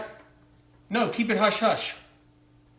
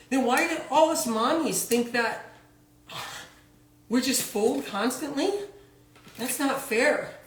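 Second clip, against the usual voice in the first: higher, fretful mom on the phone to her doctor; mock pregnant mom; 4 semitones higher than usual. Pregnant mom phoning her doctor about prenatals, higher and incredulous. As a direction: play a worried mother-to-be, shocked and indignant.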